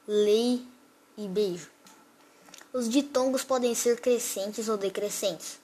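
ordinary delivery

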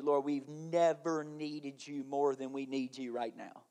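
soft, quiet prayer voice. Acted. Pastor praying over the congregation, quiet and intimate. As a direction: pray it low and hushed, close to the mic.

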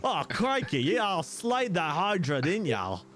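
high, hammed-up Aussie; broad mock Australian, stretched out; Australian accent. Game master joking as tavern keeper, hammed-up Australian accent. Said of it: "do an Australian accent instead".

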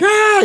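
high, loud panicked yell; hammed-up scream of fright; mock freak-out scream. Grandpa seeing a ghost on the coaster, mock panic scream. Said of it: "Grandpa starts to freak out".

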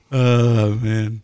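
usual speaking voice

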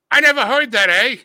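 put-on high, loud hammy Canadian accent; mock Canadian accent. Co-host mimicking amused Canadians, mock Canadian accent. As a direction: do a bad, high-pitched Canadian. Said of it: "Everybody in Canada is just laughing hysterically, going".

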